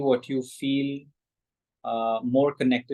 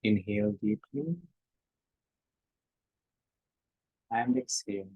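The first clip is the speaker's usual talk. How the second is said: guided breathing; 2 semitones lower, 6 dB quieter than usual. Shown as a demonstration. Teacher guiding the group's breath, softly. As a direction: gently lead the breath.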